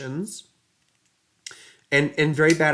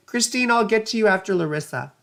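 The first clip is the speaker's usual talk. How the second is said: ordinary delivery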